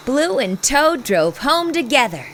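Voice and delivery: plain reading voice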